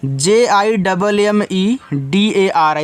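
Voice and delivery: slow letter-by-letter spelling; teacher spelling out a word for students. Shown as a demonstration. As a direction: spell out each letter slowly and deliberately. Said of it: "इसको पढ़ते हैं जिम्मेदारी".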